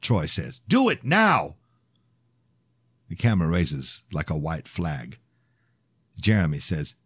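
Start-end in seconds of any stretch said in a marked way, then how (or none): 0.7-1.6 high, urgent barked order. Acted. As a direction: snap the order. Gunman orders camera up.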